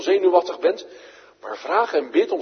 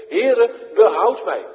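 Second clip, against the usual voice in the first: loud, high call for rescue; crying out; 7 semitones higher, 7 dB louder, slower than usual. Acted. Peter going under, crying out loud and high to the Lord. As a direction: shout it as you go under. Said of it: "riep hij zeggende".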